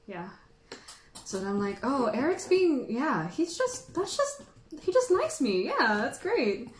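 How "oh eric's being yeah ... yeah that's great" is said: high, sing-song pretend delight; mock gushing. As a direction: play naively flattered, pitch swooping up. Acts out herself gushing about Eric.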